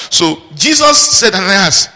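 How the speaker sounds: emphatic proclamation. Real, not acted. Preacher announcing Jesus's call to Ananias, loud and emphatic. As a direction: proclaim it loudly, stretching the words.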